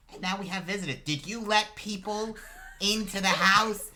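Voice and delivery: high, loud, rising to a yell; scolding mother. Acted. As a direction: scold your son from across the house. Mother scolds son about visitors.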